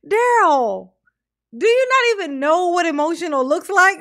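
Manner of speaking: loud, high exasperated challenge; incredulous scolding. Real, not acted. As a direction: call him out in loud disbelief. Scolds Daryl for faked emotion.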